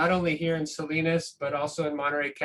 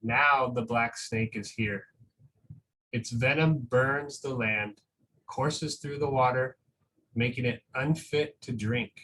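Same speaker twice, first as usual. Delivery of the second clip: slow, gentle storybook reading with long pauses; reading a picture book aloud; 3 semitones lower than usual. Acted. Teacher reading a picture book to school families, slow and gentle.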